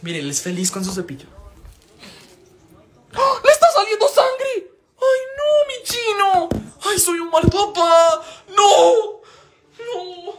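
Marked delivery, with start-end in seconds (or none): 3.1-6.5 real shriek of panic, then slow wailing; panicked cry. Real, not acted. Dog's mouth is bleeding. 6.9-10.3 high, slow wailing lament; real distress, half-laughing cry of no; guilty wail. Real, not acted. Blames himself for the dog.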